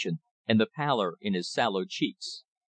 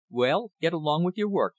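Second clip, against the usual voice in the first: higher, mildly defiant dismissal; laughing defiance; 7 semitones higher than usual. A narrator's reading. Rich man dismissing his visitor, mildly defiant. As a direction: brush them off with a touch of defiance. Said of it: "he laughed in open defiance".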